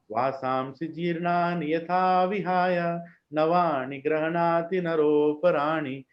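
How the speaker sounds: very slow, careful chanting of each word; slow devotional recitation. Shown as a demonstration. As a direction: savor every syllable of the verse. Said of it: "But see this shloka".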